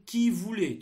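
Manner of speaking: loud, high dictation; dictating. Shown as a demonstration. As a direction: dictate it loudly and clearly. Dictation of text chunk.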